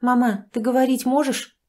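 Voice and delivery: usual speaking voice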